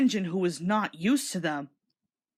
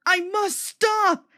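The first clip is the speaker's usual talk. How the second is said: high, very slow strained plea; desperate; 11 semitones higher, 3 dB louder, much slower than usual. A narrator's reading. Thomas skidding toward the buffers, high and strained. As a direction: force it out desperately.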